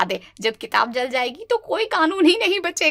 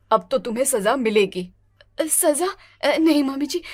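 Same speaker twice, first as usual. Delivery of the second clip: normal talking voice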